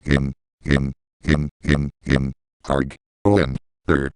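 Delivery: "in" for on, low and in separate pieces; mispronounced "on". Shown as a demonstration. Demonstrating a bug that bugs it, low, broken-up "in". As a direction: drop low and repeat "in" as isolated words. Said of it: "The eighteenth word I strangely mispronounce".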